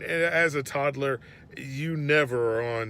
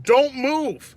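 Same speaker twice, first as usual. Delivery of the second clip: stern command; 10 semitones higher, 10 dB louder than usual. Retold or imitated. Dad buckling squirming toddler in car seat.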